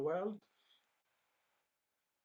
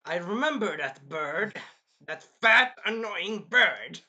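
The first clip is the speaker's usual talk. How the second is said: mock grudging voice; 6 dB louder, slower than usual.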